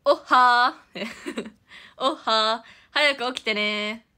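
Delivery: loud, slow, sing-song wake-up call; cute alarm voice. Shown as a demonstration. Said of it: "ゆなんちゃんの目覚ましボイスは何だろうね". (目覚ましボイスらしく甘く大きくゆっくり)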